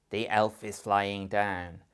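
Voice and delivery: loud, slow demo of anger; angry voice. Shown as a demonstration. Teacher models a sentence for parents, angry. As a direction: say it crossly, loud and drawn out. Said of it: "Say it in an angry voice".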